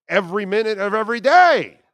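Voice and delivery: ranting about Halle Berry, loudly; emphatic rant. Real, not acted. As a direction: drive the point home loudly.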